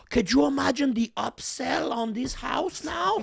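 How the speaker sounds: high, gleeful scheming voice; greedy sinister officer. Retold or imitated. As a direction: gloat over the house's value. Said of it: "He goes".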